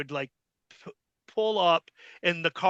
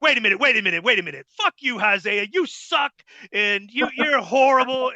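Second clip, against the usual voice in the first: higher, louder turning on him; mock heel insult; 6 semitones higher, 4 dB louder than usual. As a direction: flip to villain and insult him. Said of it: "then he's like".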